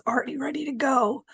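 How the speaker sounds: upbeat pep-talk coach voice; rally the team like a coach; coach's voice. Real, not acted. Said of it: "coach's voice, I hate it".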